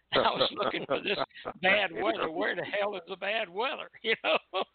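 chuckling in disbelief at the story; real laughter breaking into the telling; laughing. Real, not acted. Laughing at the fake weather excuse.

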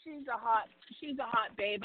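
normal talking voice

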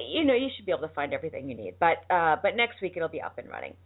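normal talking voice

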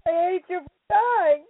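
angry cry of grief; raw anger. Real, not acted. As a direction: let the anger out at last. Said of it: "So angry".

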